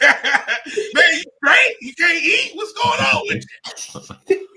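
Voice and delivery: high, loud mock concern; Anthony Anderson teasing. Comic imitating his friend needling him at breakfast, high mock concern. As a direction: pester him with high-pitched questions. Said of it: "Anthony Anderson was killing me".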